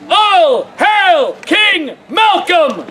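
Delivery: very slow, drawn-out chant; hailing the king. Acted. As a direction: lead the crowd, stretching every word. Leads the crowd in acclaim.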